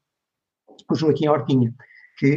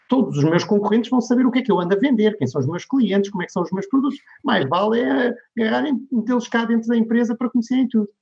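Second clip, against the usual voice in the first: imitated worried client, higher voice; retold protest, quick and raised in pitch; alarmed objection; 7 semitones higher than usual. Company boss objecting to going online, mock alarm.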